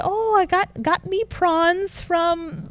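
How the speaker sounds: high-pitched English mum; lilting imitation of an English mother; imitating mother-in-law. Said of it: "she went on to say".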